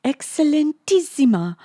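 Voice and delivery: high, loud Italian exclamation; attempted Italian accent. A narrator's reading. Lisa quoting his praise after a retake, Italian accent. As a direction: ring it out in one Italian-accented flourish. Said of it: "probably should have rehearsed my accent".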